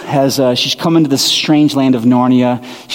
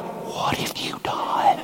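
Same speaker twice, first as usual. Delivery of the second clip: horrified whisper; 12 dB quieter, much slower than usual. Retold or imitated. Office manager aghast at the CEO snub.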